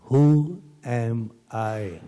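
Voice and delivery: "Who" held long, then "am", then "I"; searching, very slow meditative question; slow, deliberate. Real, not acted. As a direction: draw the question out word by word. Same question repeated very slowly.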